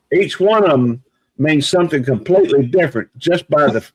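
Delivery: ordinary talk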